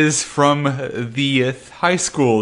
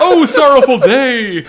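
high, loud, hammed-up tragedy; mock tragic lament; 10 semitones higher, 5 dB louder than usual. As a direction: wail it like a stage tragedian.

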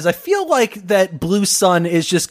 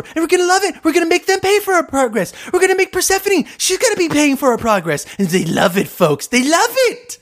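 much higher-pitched, hammed-up candidate impression; boastful candidate Red; 9 semitones higher than usual. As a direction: boast to the crowd in a high cartoonish voice. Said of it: "I've never heard your impression of that before".

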